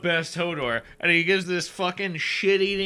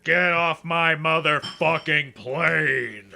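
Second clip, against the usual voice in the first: a hammy Harrison Ford, growling the line; Harrison Ford impression; 3 dB louder than usual. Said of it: "bad Harrison Ford impersonation".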